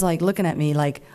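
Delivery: usual speaking voice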